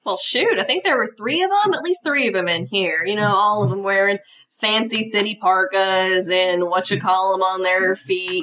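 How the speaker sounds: folksy Southern waitress gossip; small-town Southern accent. Acted. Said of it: "I don't know why Judy has this accent".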